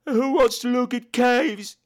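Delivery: high, whining imitation of a sulky son; petulant kid whining at his dad; mock complaining. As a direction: gripe on one high note. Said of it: "what he was complaining about".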